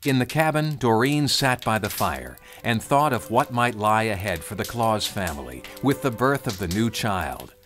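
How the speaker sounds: usual speaking voice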